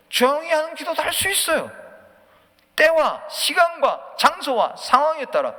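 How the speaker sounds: loud, high sermon delivery; full-force preaching. Real, not acted. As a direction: drive each phrase home to the congregation.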